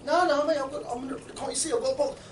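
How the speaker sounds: high-pitched hurried refusal; acted exasperated protest. Man carrying a box fends off recruiter, acted exasperation. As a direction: protest as if it should be obvious.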